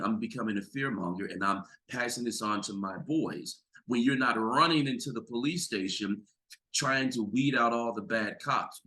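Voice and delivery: ordinary talk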